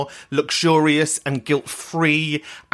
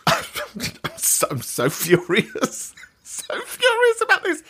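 laughing; 13 semitones higher than usual. Real, not acted. Laughing while claiming fury.

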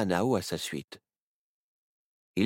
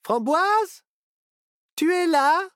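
much higher, louder call into the dark room; 8 dB louder, slower than usual. A narrator's reading. Myrtille calls for Framboise in the cellar: high, louder call. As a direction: call out to a missing friend. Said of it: "Myrtille appela".

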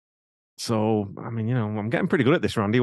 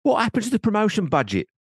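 indignant high, loud Cockney; Cockney accent; 9 semitones higher, 3 dB louder than usual. Acted. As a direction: rant in a put-on Cockney voice. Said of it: "I did a Macockney accent".

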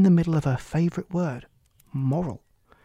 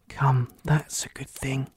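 whispering; 2 semitones lower, slower than usual. Acted. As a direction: breathe it privately. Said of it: "she said to herself".

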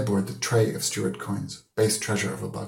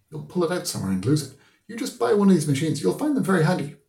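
put-on higher, louder, insistent old schoolmaster; lecturing old man; 9 semitones higher, 5 dB louder, faster than usual. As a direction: press the advice on him. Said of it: "Mister Deasy said".

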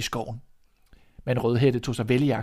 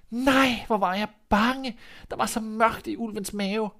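put-on high, breathless girl voice; a frightened child just rescued; relieved little girl; 10 semitones higher than usual. Red to the hunter after escaping, high and relieved.

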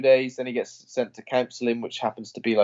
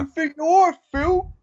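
much higher, mock-indignant jeer; mock heckle; 3 dB louder, slower than usual. Taunting Phil on screen while the audience stares, high jeer. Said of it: "I'm looking around at everyone when I say that".

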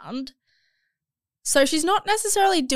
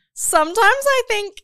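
surprised gasp; 11 semitones higher, 8 dB louder than usual. Real, not acted. Gasps at the confession.

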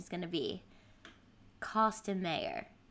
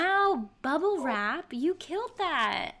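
excited; 7 semitones higher, 4 dB louder, slower than usual. Real, not acted. Praising a great audience question.

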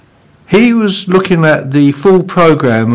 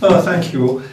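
laughing; 8 semitones higher, slower than usual. Real, not acted. Laughing after the joke.

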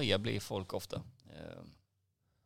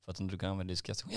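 ordinary delivery

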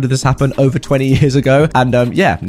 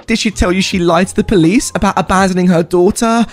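high, shrill-pitched screaming tirade, much higher; screaming sister; 8 semitones higher than usual. Retold or imitated. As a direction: rant at your dad like a furious sister. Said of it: "She starts screaming".

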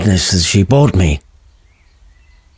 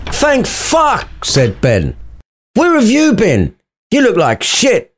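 high lad voice; 13 semitones higher, slower than usual. Acted. Ben greets Romeo.

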